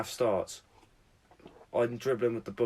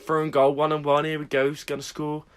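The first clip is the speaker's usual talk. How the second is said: mock commentary build-up; 6 semitones higher, 7 dB louder than usual. Mock commentary of his chance.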